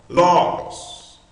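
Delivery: LOGOS with a high, strong first syllable; stress on LO. Shown as a demonstration. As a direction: punch LO, let GOS fall away in LOGOS. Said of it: "a primeira sílaba é mais forte".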